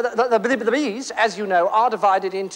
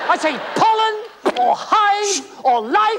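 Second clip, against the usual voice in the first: raised, higher exasperated voice; exasperated shout; 8 semitones higher, slower than usual. Acted. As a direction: throw the words out in frustration. Exasperated guest.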